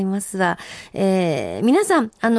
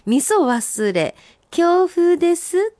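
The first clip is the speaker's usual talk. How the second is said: senryu recital; 8 semitones higher, slower than usual. Acted. Reading listener's senryu aloud.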